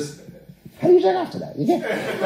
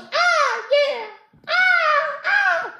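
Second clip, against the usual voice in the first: high falsetto moans, hammed-up; mock moaning; much slower than usual. Comedian imitating the porn actress, mock falsetto moans. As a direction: mimic her moaning in a squeaky falsetto. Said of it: "She's just like".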